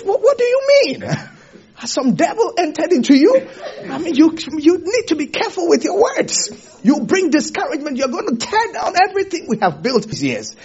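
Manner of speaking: high, fast, half-laughing outcry; shocked protest. Retold or imitated. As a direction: cry out in disbelief, then keep pressing. Peter protesting to Jesus.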